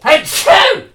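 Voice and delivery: loud high sneeze; a sudden real sneeze; sneezing. Real, not acted. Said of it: "Sorry, I don't know what that was".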